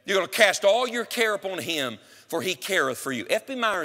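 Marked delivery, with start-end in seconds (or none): none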